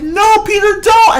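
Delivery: high, loud desperate yell; frantic shout. Retold or imitated. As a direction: scream it like trying to stop him. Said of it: "he was like".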